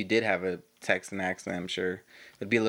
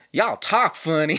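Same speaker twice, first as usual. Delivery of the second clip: slow, loud, high mock Texan; amateur imitation of a Texan cousin's accent; imitated Texan accent; 6 semitones higher, 6 dB louder, slower than usual. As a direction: attempt a thick Texan drawl, drawn out. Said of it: "I can't do the accent as well as you".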